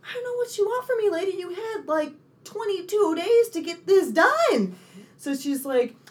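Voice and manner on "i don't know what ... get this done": high, exasperated snap at the lady; mock outburst at a pushy customer; exasperated retort. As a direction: voice shooting up in disbelief. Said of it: "I was just sitting there like".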